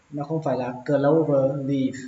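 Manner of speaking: usual speaking voice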